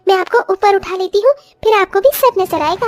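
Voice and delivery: put-on high voice of a young daughter-in-law offering help. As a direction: lift the voice into an eager young bride.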